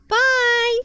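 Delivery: very high, loud sing-song bye; high squeaky goodbye; chirpy bye. Shown as a demonstration. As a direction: toss off a bright cartoon bye. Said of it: "you go".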